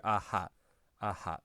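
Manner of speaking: "aha" with a breathed h through open lips; lips opened further. Shown as a demonstration. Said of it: "if you open them even further".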